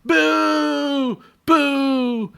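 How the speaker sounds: high mock booing from the crowd; audience booing. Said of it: "You're supposed to boo Captain Hook".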